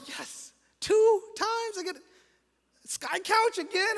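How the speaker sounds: high, slow gleeful celebration; hammed-up, drawn-out delight; mock elation. Retells joy at getting same row.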